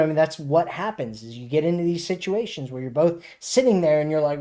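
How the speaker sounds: ordinary talk, nothing marked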